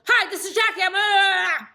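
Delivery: high, shouted telemarketer greeting; hammed-up, blaring sales pitch; over-the-top loud caller. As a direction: blast the greeting, far too loud and fast. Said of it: "The opposite is also true".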